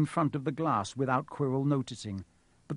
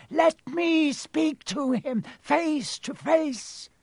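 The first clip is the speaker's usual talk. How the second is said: very high, slow, eerie command; 13 semitones higher, much slower than usual. A narrator's reading. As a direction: intone the order high and drawn out. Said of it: "The high voice spoke again".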